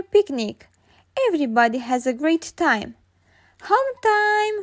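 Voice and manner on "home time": calling out. Acted. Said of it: "cries Madame Gazelle".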